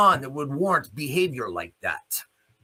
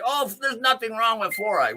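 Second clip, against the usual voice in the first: mocking quote; 9 semitones higher than usual. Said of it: "everybody that wants to sit back and say".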